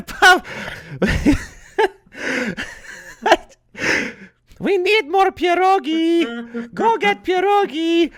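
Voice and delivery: piercingly high, drawn-out mock wife; hammed-up falsetto nagging, stretching the vowels; Dominika impression. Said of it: "this is my Dominika impression".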